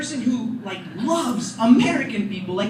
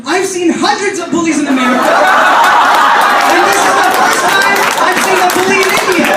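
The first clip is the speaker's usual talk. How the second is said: louder, higher earnest declaration; proud kid shout; 5 semitones higher, 8 dB louder than usual. Retold or imitated. Brother to crying cousin, loud earnest announcement. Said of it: "my brother goes".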